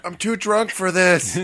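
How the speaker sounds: slow, high mock yell; yelling back. Teasing a friend about yelling back at twelve-year-olds, drawn-out mock yell. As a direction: holler it back like a tipsy adult. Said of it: "did you yell back".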